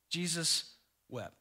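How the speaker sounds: quiet, grave scripture reading; hushed solemnity. Real, not acted. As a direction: let the two words fall gently. First, solemn reading of verse.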